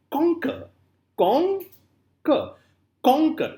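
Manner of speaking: "conquer" with a high, forceful CON; stress on CON. Shown as a demonstration. Teacher showing students a trochee, stress on CON. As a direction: press hard on CON in "conquer", let "quer" drop. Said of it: "emphasis is on the word con".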